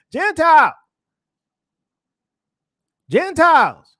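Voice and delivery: loud, high preacher's shout; calling out. Acted. Preacher summons the Gentiles as the heavenly voice, calling out. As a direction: cry out the word like a summons.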